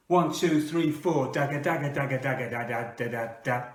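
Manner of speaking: mock guitar riff chant; punk count-in.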